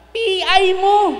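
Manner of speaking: put-on high, loud, slow furious curse at the child; mother yelling. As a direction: yell it like an angry mother. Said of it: "Sabi niya".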